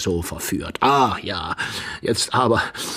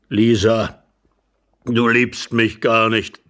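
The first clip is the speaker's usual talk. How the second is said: put-on slow, louder old man's voice; reproachful old husband; 5 dB louder, slower than usual. Old husband tells Lisa she doesn't love him, reproachful. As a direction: drag out the reproach.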